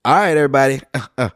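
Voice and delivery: lightly voiced big shot making an entrance; big shot calling out to the crowd, hammed-up; Tony impression. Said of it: "He was just".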